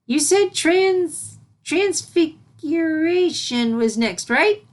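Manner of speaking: high, slow, stumbling over the long word; a small filly trying to say a big word; eager filly voice. A narrator's reading. Filly asking Celestia about the next lesson, eager.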